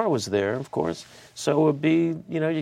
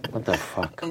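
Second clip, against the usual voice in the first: low, annoyed grumble; muttered angry curse; 5 semitones lower, 4 dB quieter than usual. Pretend. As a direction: swear it under your breath. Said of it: "He's really mad".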